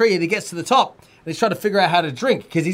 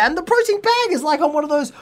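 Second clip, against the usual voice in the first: ordinary talk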